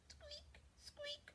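tiny, very high, soft squeak; high-pitched whispery mouse squeaks. Acted. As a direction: squeak softly like a little mouse. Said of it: "What does that sound like".

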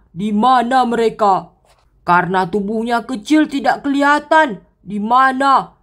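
deep looming brute searching; menacing giant. Acted. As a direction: growl like a giant hunting mice. Giant hunting the kids.

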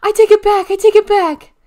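very high, very loud panicked scream; high-pitched full-out screaming plea. A narrator's reading. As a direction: scream for release, nose being twisted. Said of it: "till he screamed".

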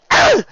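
loud, high grunt of exasperation; frustrated groan. A narrator's reading. Rarity fighting the harness, frustrated groan. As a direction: groan sharply with effort.

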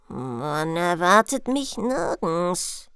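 put-on low, very slow old stranger; mysterious old one. Old stranger answering the princess's question, mysterious. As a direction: draw it out low and weighty.

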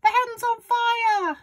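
very high, drawn-out alarm yell; yelling grandma. A narrator's reading. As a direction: raise the alarm, stretching FIRE. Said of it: "Grandma yelled".

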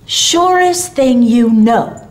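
slow, loud, hearty assurance; emphatic, drawn-out promise; Santa Claus. A narrator's reading. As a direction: draw it out big to reassure a child. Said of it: "answered Santa Claus".

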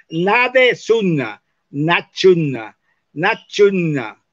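NADE SUNNA spelt out, then NACHUNNA with a ch; letters run together. Shown as a demonstration. Teacher showing how D and S make che, slow pronunciation demo of "nachunna". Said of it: "el sonido che. Por ejemplo, que yo digo".

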